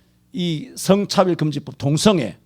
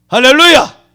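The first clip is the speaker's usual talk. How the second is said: 할렐루야 called out higher and louder; loud hallelujah; 8 semitones higher, 8 dB louder than usual. Real, not acted. Preacher prodding a silent congregation, louder 할렐루야. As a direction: call out 할렐루야 to rouse an amen. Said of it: "왜 아멘을 안 해?".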